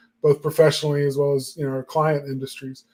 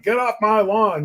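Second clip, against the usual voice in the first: lightly voiced cranky old man, pitched up; a hint of an old man's complaint, higher; mock grumpy old man; 6 semitones higher than usual. Joking about getting mad online, mock old-man grumble. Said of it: "I'll become the old man who's like".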